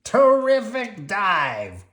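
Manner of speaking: gruff grouchy Oscar; grumpy garbage-can grouch. Acted. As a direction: growl it like a grouch. Oscar praising.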